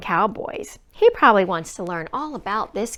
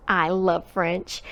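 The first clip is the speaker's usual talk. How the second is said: usual speaking voice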